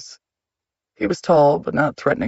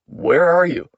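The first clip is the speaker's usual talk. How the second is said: raised, higher shout; 4 semitones higher, 5 dB louder, slower than usual. A narrator's reading. Husband stomping through the house after his wife, shouting. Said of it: "he shouted to her".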